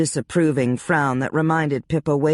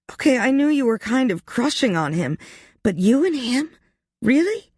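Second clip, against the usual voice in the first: high disbelieving voice climbing higher; incredulous; 10 semitones higher than usual. Acted. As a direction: rise in disbelief on Really. Ashley stunned by the news.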